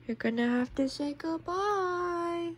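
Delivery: slow, high, soft cooing; cooing to pets. Real, not acted. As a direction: draw it out softly for a pet. Talking to kittens before parting.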